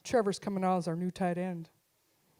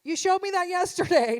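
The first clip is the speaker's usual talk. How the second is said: exasperated mom; 8 semitones higher, 6 dB louder than usual. Retold or imitated. Mom fed up with flexing son.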